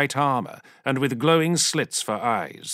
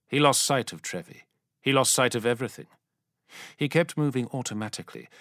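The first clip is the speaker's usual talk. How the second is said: ordinary delivery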